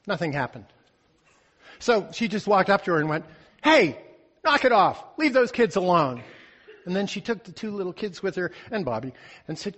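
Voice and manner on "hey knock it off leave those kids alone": loud, high scolding call; firm telling-off. Acted. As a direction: call out the bully firmly. Confronting a bully.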